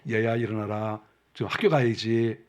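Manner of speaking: put-on mother gently waking her child for school; gentle mother. Shown as a demonstration. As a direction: wake a sleeping child lovingly. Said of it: "조용히 가서 사랑하는 마음으로".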